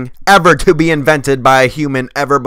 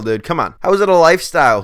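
ordinary talk, nothing marked

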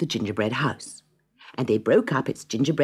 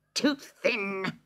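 higher, sour witch complaint; snappish witch; 8 semitones higher than usual. Acted. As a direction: grumble "Too thin" with the first word stretched. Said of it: "she snapped".

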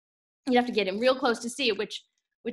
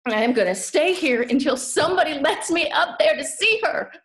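determined; 5 dB louder than usual. Acted. Child refusing to leave until mama hears, loud and determined. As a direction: plant yourself and declare it loudly.

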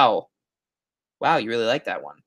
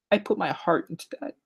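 softer, higher mock-hurt plea; half-joking, small wounded voice; mock heartfelt; 5 semitones higher, 8 dB quieter, faster than usual. Streamer jokingly pleading for views, mock heartfelt.

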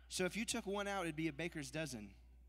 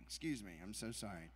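usual speaking voice

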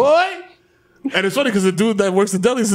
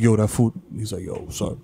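much lower, a deep put-on friend's voice; imitating Chief; 11 semitones lower, 5 dB quieter than usual. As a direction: drop way down to voice your buddy.

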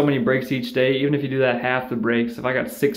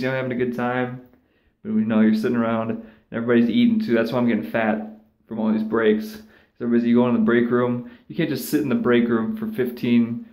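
usual speaking voice

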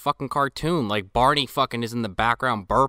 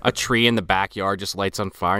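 ordinary talk, nothing marked

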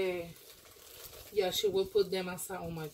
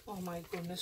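fast, much softer gasp of awe; hushed astonished oh my goodness; breathless surprise; 3 semitones lower, 13 dB quieter, faster than usual. Real, not acted. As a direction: gasp it out, barely voiced. Gasp at the gift.

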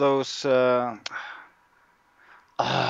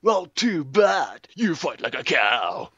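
hammed-up taunt; 3 semitones higher, 3 dB louder, much slower than usual. Improvised insult duel.

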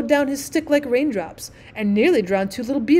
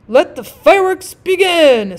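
slow, much higher and louder proclamation; royal command; 7 semitones higher, 9 dB louder, slower than usual. A narrator's reading. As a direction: announce it grandly to the court. King commands the display.

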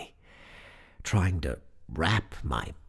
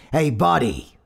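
loud, higher-pitched call; Brooklyn motorman's yell; 7 semitones higher, 7 dB louder than usual. A narrator's reading. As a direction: call out to get a passenger's attention. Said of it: "Brooklyn yelled at me".

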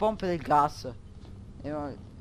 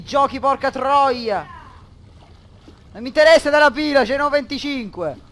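higher, much louder cursing; frustrated yell; 7 semitones higher, 10 dB louder than usual. Real, not acted. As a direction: vent at the game that scares you. Frustrated at the horror game.

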